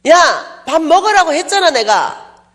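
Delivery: high, loud indignant call; friend shouting. Retold or imitated. Friend shouting at the preacher to come eat, loud indignant call. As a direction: yell after her to come eat. Friend yelling after refusal.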